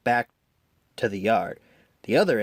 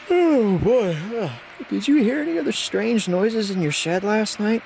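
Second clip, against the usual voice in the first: tired Thomas; 10 semitones higher than usual. A narrator's reading. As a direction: sound worn out but curious. Tired Thomas asks Percy.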